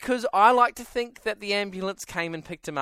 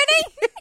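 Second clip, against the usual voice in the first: burst of laughter; 8 dB louder than usual. Real, not acted. Laughs at own story.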